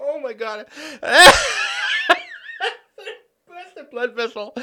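wheezing laugh-cry; tears of laughter, choking on words. Real, not acted. Host losing it over the article, crying laughing.

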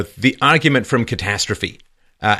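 ordinary talk, nothing marked

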